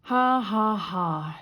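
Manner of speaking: flat, forced mournful ha ha; a laugh with no joy in it; half-hearted sorrowful ha ha ha; doleful laugh. A narrator's reading. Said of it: "she said dolefully".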